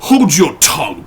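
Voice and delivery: sharp ferocious command to the maid. Acted. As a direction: butler with jangled nerves, fierce rebuke. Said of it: "with a ferocity of accent".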